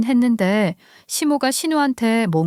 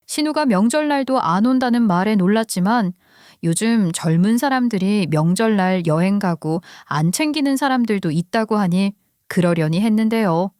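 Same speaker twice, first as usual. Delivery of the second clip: ordinary delivery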